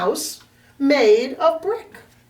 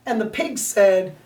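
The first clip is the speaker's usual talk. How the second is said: plain reading voice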